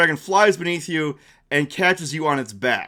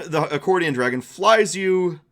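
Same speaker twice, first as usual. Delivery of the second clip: ordinary talk, nothing marked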